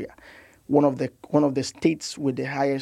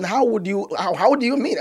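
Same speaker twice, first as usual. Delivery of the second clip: retold shock, higher pitched; taken aback, stumbling into the question; 5 semitones higher than usual. Health head reacting to the young doctor's bold claim, shocked. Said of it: "You know, the, the shock".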